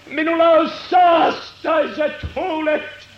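anguished cry. Acted. Prophet crying to God in anguish, building cry. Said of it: "Hän huutaa sydämen tuskassa".

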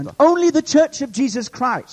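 high, loud proclamation; fervent preacher at full voice; full-force preaching. Real, not acted. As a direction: drive it out to the whole congregation.

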